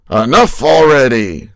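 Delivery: loud exasperated outburst; mock exasperation. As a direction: burst out, fed up on the deathbed.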